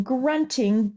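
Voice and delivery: ordinary delivery, nothing marked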